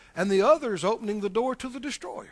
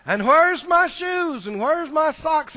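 mock complaint; 5 semitones higher, 5 dB louder, slower than usual. Acting out grumbling person.

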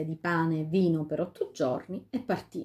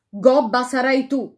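slow, louder, spiteful retort; cursing fairy; 5 semitones higher, 8 dB louder than usual. A narrator's reading. The fairy curses the queen with a hump. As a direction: spit the curse back at her.

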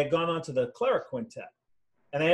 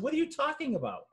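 feigned innocence; 11 semitones higher, 3 dB quieter than usual. Retold or imitated. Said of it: "I said".

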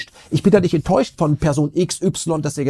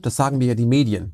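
ordinary delivery

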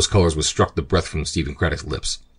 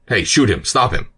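shouting; 5 semitones higher, 3 dB louder than usual. Acted. Pirate yells as prisoner escapes.

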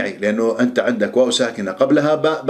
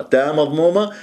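ordinary delivery

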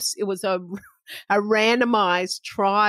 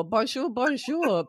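put-on French accent; 4 semitones higher, 4 dB quieter than usual. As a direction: greet the panel with a timid French accent. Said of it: "don't laugh at my French accent".

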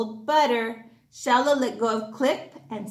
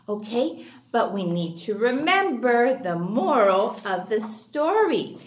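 plain reading voice

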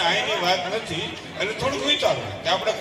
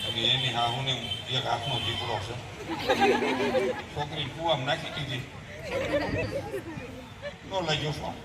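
low, hushed, anxious mother muttering to herself; worried mother demo; 4 semitones lower, 6 dB quieter than usual. Acted. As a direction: play a fretful mother whose daughter won't pick up. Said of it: "જરાક demo બતાવું છે". (ચિંતાતુર માતાનો ધીમો, ગભરાયેલો અવાજ)

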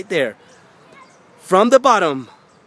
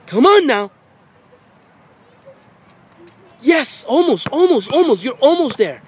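rising, loud encouragement, climbing higher as she gets close; higher and louder courtside coach cheer; excited cheering; 9 semitones higher, 4 dB louder than usual. Real, not acted. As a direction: cheer her on toward the basket. Parent cheering child's shots.

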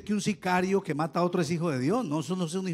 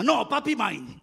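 lightly voiced small boy, a bit higher; little boy voice; 7 semitones higher, 5 dB louder, slower than usual. Pastor voices his son grabbing his leg, lightly childlike. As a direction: play the jealous toddler claiming dad.

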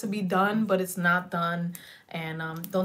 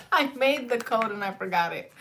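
laughing; 4 semitones higher, 4 dB louder than usual. Real, not acted. Laughing at herself.

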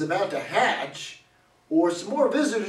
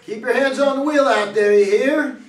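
calling out; 5 semitones higher, 7 dB louder than usual. Acted. Calling after departing newlyweds.

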